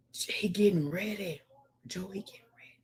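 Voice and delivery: hushed whisper, voice dropped away. Real, not acted. Streamer whispering to viewers about his friend Joe, hushed. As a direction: whisper it like a secret. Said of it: "whispering the phone news".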